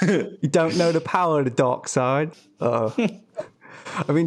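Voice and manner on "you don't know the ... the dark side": higher-pitched mock Cockney threat; Cockney Darth Vader. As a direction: menace undercut by a Cockney accent. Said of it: "Darth Vader with a Cockney accent".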